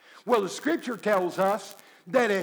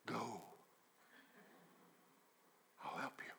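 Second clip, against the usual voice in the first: gentle God voice; 10 semitones lower, 25 dB quieter than usual. Acted.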